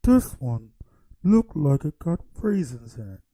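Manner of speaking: loud, put-on sassy relative voice; family voicing judgement at dinner, loud hammed-up sass; mock family member. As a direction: ham up a judging auntie. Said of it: "we can be like".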